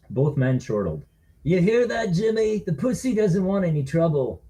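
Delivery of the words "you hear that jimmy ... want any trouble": drunk bully mocking; slurred drunk. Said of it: "he said with slurred speech".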